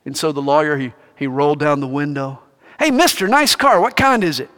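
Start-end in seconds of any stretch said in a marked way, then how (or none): 2.8-4.5 put-on eager kid. Boy voiced in sermon story.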